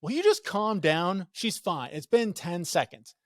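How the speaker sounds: acted exasperation, slow and emphatic; retold outburst. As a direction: re-enact an impatient husband's outburst.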